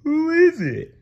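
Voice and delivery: high, loud cartoon question; Patrick voice. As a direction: ask eagerly. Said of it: "Patrick asks".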